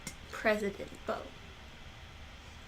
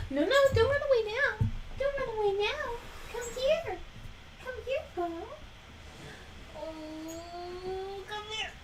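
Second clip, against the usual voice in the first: high pet-talk voice; 10 semitones higher than usual. Real, not acted. Coaxing the dog back to her in high cooing baby talk.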